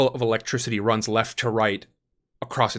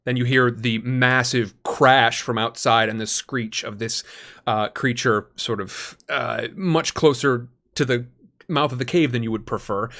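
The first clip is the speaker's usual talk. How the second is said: ordinary talk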